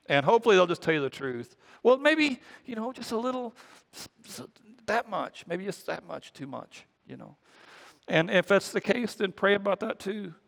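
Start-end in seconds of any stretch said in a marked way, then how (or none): 1.8-7.4 high-pitched hedge trailing into near silence; sheepish, halting confession fading away; reluctant admission. Acted. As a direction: start high, then shrink to a tiny voice. Acts friend reluctantly admitting the truth.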